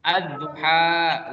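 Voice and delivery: "Ad-duha" slow, with the d doubled; tajwid reading. Shown as a demonstration. Teacher models the reading for the class, slowly. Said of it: "alif lam syamsiah".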